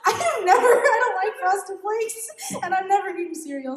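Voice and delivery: breaking into giggles mid-sentence; laughing at yourself, can barely get it out. Real, not acted. Laughing at her own story.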